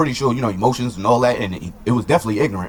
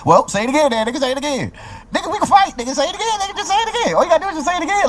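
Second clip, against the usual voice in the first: much higher, hammed-up taunting dare; mock taunt; faster than usual. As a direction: egg him on to fight, in a squeaky mock voice. Retold taunt daring a fight.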